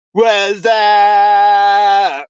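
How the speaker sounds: high goofy yell held very long; movie catchphrase shout. Acted. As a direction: belt it out like the movie gag, dragging the vowel. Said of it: "Two thousand screen movie back in the nineties".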